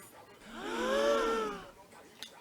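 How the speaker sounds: long, high-pitched gasp; shocked gasp. Acted. Girlfriend hearing boyfriend's confession, high shocked gasp.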